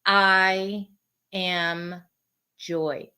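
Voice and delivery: one word at a time, long pauses; soft, low and very slow, meditative; slow affirmation. Shown as a demonstration. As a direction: let each word settle before the next. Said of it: "close your eyes and say".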